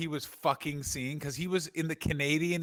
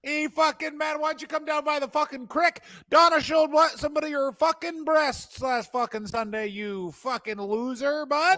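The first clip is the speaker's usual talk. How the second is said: small-town bully; 9 semitones higher than usual. Retold or imitated. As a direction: jeer at Kenny across the creek. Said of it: "guys like this".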